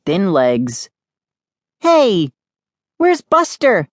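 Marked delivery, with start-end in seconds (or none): 1.8-3.9 high, questioning call. A narrator's reading. Sister looking for the dog, calling out. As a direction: call out for a missing pet. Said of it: "she called out".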